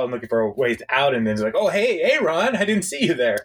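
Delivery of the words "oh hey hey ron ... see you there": fake casual. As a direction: pretend you didn't see him. Andy caught awkwardly, acting casual.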